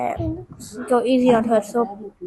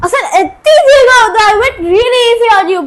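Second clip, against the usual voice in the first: high loud frustrated outburst; exasperated; 10 semitones higher, 9 dB louder, faster than usual. Real, not acted. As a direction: throw up your hands in disbelief. Frustrated, sister can't guess.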